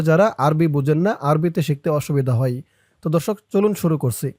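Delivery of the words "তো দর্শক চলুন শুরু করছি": ordinary delivery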